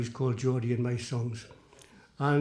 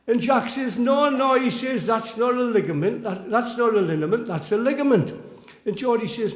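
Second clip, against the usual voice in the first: higher, emphatic recited mock argument; animated storyteller voicing two squabbling mates; comic quibbling dialogue; 5 semitones higher, 4 dB louder than usual. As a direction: bat the corrections back and forth. Performs poem's mounting quibble.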